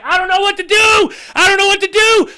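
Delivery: very high, panicked wail, re-enacted; freaking out. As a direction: act out helpless panic at the wheel. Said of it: "I'm freaking out".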